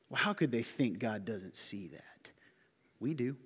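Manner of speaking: hushed aside, half whispered. Real, not acted. Preacher whispers an aside to the congregation. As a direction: lean in and whisper it. Aside after quoting Cain.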